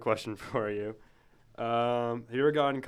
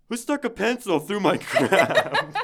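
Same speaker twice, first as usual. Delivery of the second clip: angry teacher; 10 semitones higher, 9 dB louder, slower than usual. Retold or imitated. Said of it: "He said".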